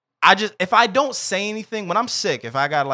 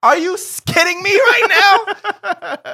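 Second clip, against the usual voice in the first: higher, louder disbelief; incredulous outrage; 13 semitones higher, 4 dB louder than usual. Real, not acted. Friend ranting at all the national days, higher and incredulous. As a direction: ask as if you can't believe it.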